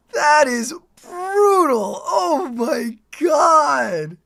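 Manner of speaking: slow, high, crying-out shout; awed shout. Real, not acted. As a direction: shout it in disbelief. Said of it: "second take, shouted".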